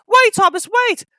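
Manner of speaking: high, loud pleading cry; trucks calling. A narrator's reading. Trucks calling after Thomas, high and loud. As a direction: beg him to wait.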